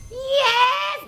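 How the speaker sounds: high-pitched, put-on elderly answer; old person voice. Miss Vernita answering Sade's call, old person voice. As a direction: answer the phone like an old lady. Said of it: "using her old person voice now".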